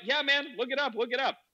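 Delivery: high, excited acted reply; eager urging. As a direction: urge him on with hyped-up energy. Acting own eager urging.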